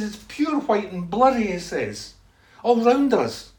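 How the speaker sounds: loud, high wonder; awestruck. Retold or imitated. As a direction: describe a vision in amazement. Retold man describes vision.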